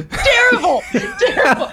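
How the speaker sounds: high-pitched helpless laughter through the word; real laughter breaking up the words; laughing agreement. Real, not acted. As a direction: squeal it out while laughing. Laughing agreement she lied badly.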